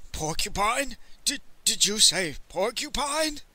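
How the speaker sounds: taken aback, voice lifting; alarmed disbelief. A narrator's reading. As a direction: stumble into a startled question. Merchant alarmed by the news.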